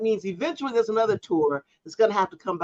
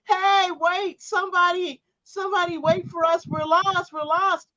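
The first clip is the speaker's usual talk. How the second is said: high-pitched plaintive calling out; hammed-up stranded tourist cry; mock yelling for help; 11 semitones higher than usual. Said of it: "They can't yell and say".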